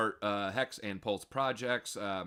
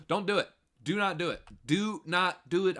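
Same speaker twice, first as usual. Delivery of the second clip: urgent warning; 5 semitones higher, 4 dB louder than usual. Real, not acted. Pleading warning, louder.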